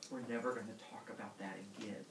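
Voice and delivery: low, soft and quick; furtive aside. Acted. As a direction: mutter it to close the matter. Father covering up his deed.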